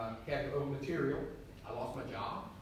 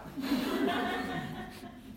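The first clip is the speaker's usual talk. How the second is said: breathy high burst of laughter; real laughter. Real, not acted. Pastor cracking up at his own story, laughing hard.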